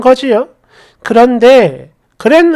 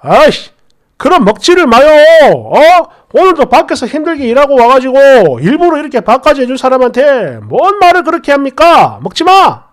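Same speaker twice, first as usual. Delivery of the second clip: 남편이 버럭하는 연기; 아내 편을 들며 부모에게 따지듯; 4 dB louder than usual. Acted. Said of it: "남편이 바로 버럭하더라고". (버럭 화내는 남편 흉내)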